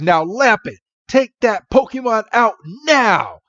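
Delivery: high-pitched order, rising to the end; mock battle command. Trainer ordering Lampent to attack, retold as mock battle command. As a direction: give a dramatic cartoon attack order.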